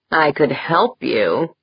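slow, suggestive offer; leering. A narrator's reading. Jaeger offering Deirdre help unpacking, slow and leering. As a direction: draw it out with a mild leer. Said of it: "Jaeger leered".

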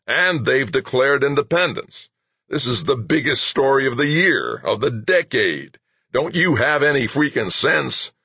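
high-pitched, heated rant; exasperated tirade. A narrator's reading. Boss berating staff over the year's biggest story, exasperated. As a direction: berate them in outraged exasperation.

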